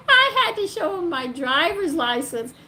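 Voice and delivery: real laughter through the words; amused, laughing recollection. Real, not acted. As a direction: tell it while laughing, high and loud. Laughs at her own memory.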